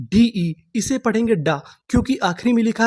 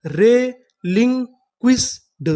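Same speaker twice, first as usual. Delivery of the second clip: very slow, drawn-out reading; रेलिंक्विस्ड drawn out slowly, syllable by syllable; slow syllable demo; 2 semitones higher, much slower than usual. Shown as a demonstration. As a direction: stretch each syllable of रेलिंक्विस्ड. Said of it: "तो हमारी पूरी meaning क्या बनी?".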